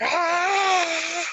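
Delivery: high-pitched drawn-out scream, imitating a wrestler; imitated scream. Retelling a wrestler's agony to a friend, imitated scream. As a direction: mimic a long, panicked scream. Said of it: "fucking screaming".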